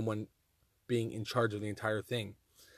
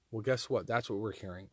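usual speaking voice

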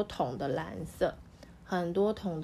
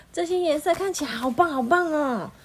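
high, louder delighted cry; excited cry; 8 semitones higher, 7 dB louder than usual. Acted. Neighbours cry out happily at the colours. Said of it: "都叫了起来".

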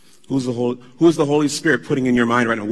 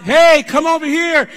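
higher, louder beckoning call; mock come-here call; 9 dB louder than usual. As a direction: wave them over like a salesman. Quoting the wrong commission.

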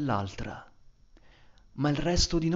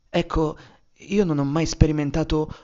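ordinary talk

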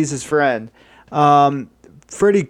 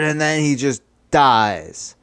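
plain reading voice

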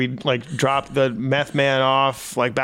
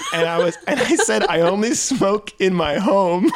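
laughing through it; 6 semitones higher than usual. Real, not acted. Laughing while retelling the meth offer.

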